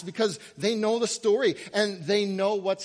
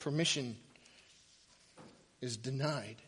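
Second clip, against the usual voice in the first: hushed; 5 semitones lower, 8 dB quieter than usual. Real, not acted. Solemn turn in sermon.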